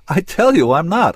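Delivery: loud, high-pitched protest; insistent denial. A narrator's reading. Man denying to her that he is angry, exasperated.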